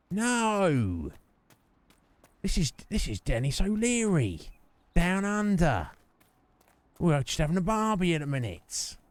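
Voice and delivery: high, hammed-up Aussie voice with drawn-out words; bad Australian accent. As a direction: answer in a badly faked, high-pitched Australian accent. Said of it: "not a very good Australian accent".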